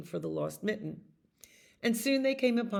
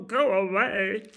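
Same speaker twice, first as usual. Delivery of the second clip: firm, raised-voice rejection; dismissive; 6 dB louder than usual. A narrator's reading. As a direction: shoo them away loudly. Animals turn the mouse away.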